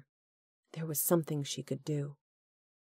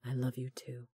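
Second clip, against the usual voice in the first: soft tender; 3 semitones lower, 9 dB quieter than usual. Acted. Corbin answers tenderly.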